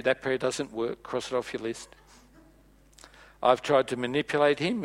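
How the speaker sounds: usual speaking voice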